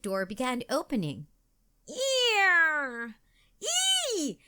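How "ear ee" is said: high storybook shriek; frightened squeal in two bursts; acted scream. A narrator's reading. Katie sees the closet door opening, high acted scream. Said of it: "screamed Katie".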